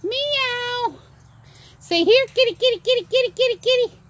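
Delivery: teaching the parrot words in a high voice; high pet voice. Real, not acted. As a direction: coax the bird in a high lilt.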